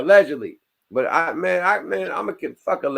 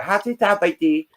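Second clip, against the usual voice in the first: high, hammed-up parroting of 'Hati tapati'; mock foreign phrase; 7 semitones higher than usual. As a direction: parrot the strange words in a mock voice. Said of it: "She started speaking their language".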